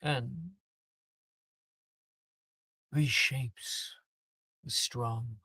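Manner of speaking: a headmaster preaching change to students; stern headmaster address. Acted. GM voicing headmaster speech.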